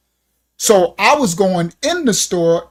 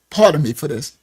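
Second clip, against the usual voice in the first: normal talking voice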